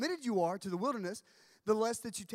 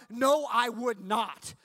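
usual speaking voice

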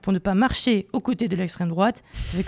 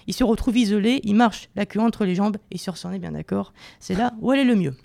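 ordinary talk, nothing marked

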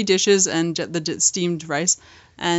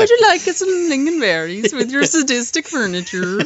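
higher mock Swedish accent; lilting mock Swedish shopkeeper, higher; 6 semitones higher, 4 dB louder than usual.

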